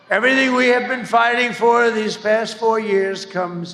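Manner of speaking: loud rally call, pushing hard; rally speech. Acted. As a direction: rouse the crowd from the podium.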